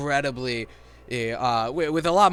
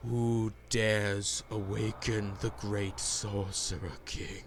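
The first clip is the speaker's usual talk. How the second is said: ancient rasping undead king; 6 semitones lower, 8 dB quieter, slower than usual. Acted. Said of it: "bellows out as he rises from his grave".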